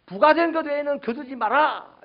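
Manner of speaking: loud, high shouted order; John the Baptist. Retold or imitated. John crying out to tax collectors, shouting. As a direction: cry it out as a stern command. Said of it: "이렇게 소리쳤어요".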